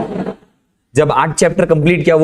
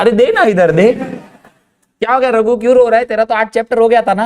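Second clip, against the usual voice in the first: higher-pitched scolding mum; taunting mother; 6 semitones higher than usual. Acted. Mother grabs the phone to taunt her son's crying friend, high-pitched nagging.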